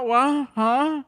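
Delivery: mock complete incomprehension; baffled. Friend lost at the drive-thru, baffled. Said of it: "Pastor is not understanding this woman".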